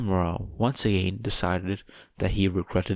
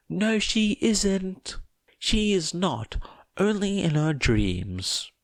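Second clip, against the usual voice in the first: much higher, slow sneering voice; mocking retort; 11 semitones higher, slower than usual. Tomura scornfully denying Toga dates Izuku. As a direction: drag it out with scorn.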